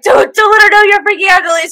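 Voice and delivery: panicking, trying to stay cool; imitating Ed freaking out; panicked inner voice. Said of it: "and he's like".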